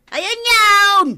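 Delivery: loud, high call across the yard. Acted. Searching for the rooster, loud high call. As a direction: call out for the lost birds.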